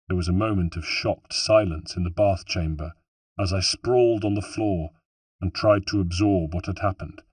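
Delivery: ordinary delivery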